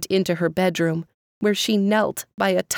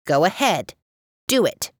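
defiant bark. A narrator's reading. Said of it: "the chair barked back".

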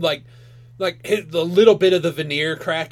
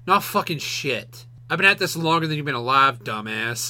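veiled scorn; 7 semitones lower, 5 dB quieter than usual. Retold or imitated. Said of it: "The undertone was like".

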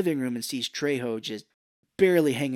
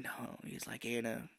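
weak dying plea; 13 dB quieter than usual. Retold or imitated.

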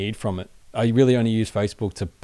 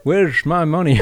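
put-on deranged old man on the phone, louder; grumpy old man; 7 semitones higher, 6 dB louder, slower than usual. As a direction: whine it like a cranky old caller. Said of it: "put on this old man voice".

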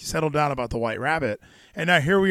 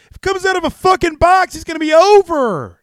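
high, loud ranting promoter yell; a screeching Cornette rant about the box; Jim Cornette impression; 14 semitones higher, 8 dB louder than usual. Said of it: "that's my Cornette".

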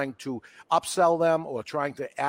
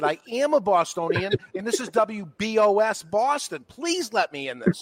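put-on high, begging young DJ; mock pleading; 8 semitones higher than usual. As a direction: plead to be let on the air.

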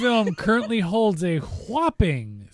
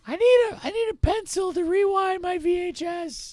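put-on high whiny child voice; kid voice. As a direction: beg like an eager kid. Kid voice continues.

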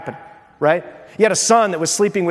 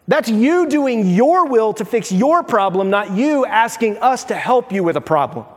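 ordinary delivery

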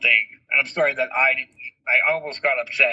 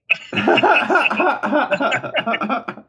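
laughter; 8 semitones higher than usual. Real, not acted. Laughing at own joke.

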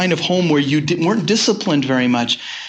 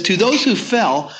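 solemn scripture reading; 5 semitones higher than usual. Acted. Quotes Paul in sermon.

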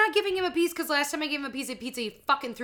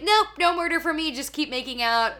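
put-on playful kid voice; 5 semitones higher, 5 dB louder than usual. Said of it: "I'd be like".

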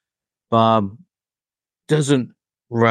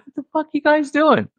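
much higher, raspy mock [name] outburst; imitating [name]. [name] walking in on the band, mock irritated outburst. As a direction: bark it like an irritated old rocker.

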